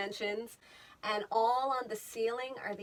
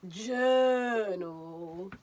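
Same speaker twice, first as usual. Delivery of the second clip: fancy British accent; 5 dB louder than usual. Shown as a demonstration. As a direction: say "Journal" the fancy British way, rising. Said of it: "That's the fancy British accent".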